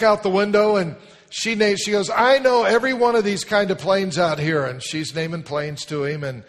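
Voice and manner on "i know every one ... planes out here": put-on proud little girl. Girl boasting about planes.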